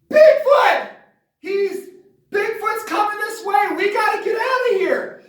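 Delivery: high, loud frantic warning; hammed-up terrified yell; panicked shout. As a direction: scream the warning at your family. Warning family of Bigfoot.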